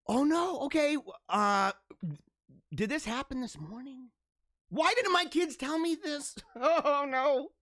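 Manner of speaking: high, startled oh no; real surprise. Real, not acted. Host finds photos his kids never mentioned, startled and high. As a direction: caught off guard, voice jumps up. Discovers morning school mass photos.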